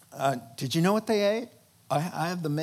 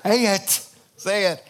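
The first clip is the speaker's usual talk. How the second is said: louder, urgent whisper-plea; urgent urging; 3 semitones higher, 5 dB louder than usual. Retold or imitated. Angels urging Esther to speak, urgent. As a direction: egg her on, tense. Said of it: "the angels are like".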